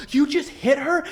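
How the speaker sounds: put-on high, loud accusing kid voice; mock shocked kids. Classmates confronting John, high loud mock accusation. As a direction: squeal it like a shocked mob of kids. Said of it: "this mob of kids would come over".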